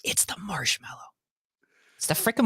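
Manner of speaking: hushed, voiceless reveal; confessional whisper. Real, not acted. Dramatic reveal of what he hates.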